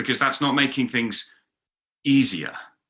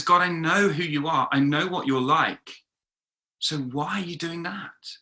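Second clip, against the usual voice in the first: higher-pitched appeal, softening on the final question; bewildered pleading; 6 semitones higher than usual. Acted. Habakkuk questioning the God he knows, puzzled pleading. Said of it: "Do you hear him?".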